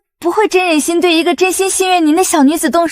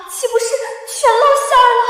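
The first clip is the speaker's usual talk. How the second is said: high, alarmed inner cry; rising panic at being found out; panicked realisation; 8 semitones higher than usual. Acted. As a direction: let the pitch jump as the dread hits. Realizes her lies are exposed.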